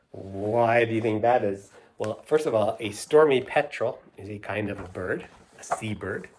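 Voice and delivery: plain reading voice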